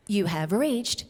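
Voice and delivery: slow, low, deliberate satnav announcement; GPS navigation voice. Shown as a demonstration. Demonstrating the spooky next one for the audience, GPS voice. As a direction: announce arrival like a recorded guide. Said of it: "while I say the next one".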